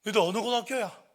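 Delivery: loud, high interrogation; forceful questioning yell; shouted demand. Retold or imitated. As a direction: shout like an authority demanding answers. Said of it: "얘들한테 제가 소리쳤어요".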